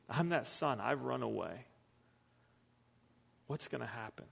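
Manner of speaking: soft hushed confession of a tax collector; hushed anxious sinner. Retold or imitated. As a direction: confide it softly, as if afraid to hope. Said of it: "sinners and tax collectors ... are going".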